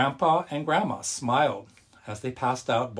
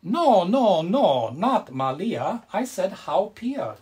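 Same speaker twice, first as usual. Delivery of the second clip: high, loud, then slow insistent correction; exclaiming grandma; 7 semitones higher, 6 dB louder, slower than usual. A narrator's reading. Grandma corrects grandpa's mix-up, high and slow. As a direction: correct him firmly, slowing down. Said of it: "Grandma exclaimed".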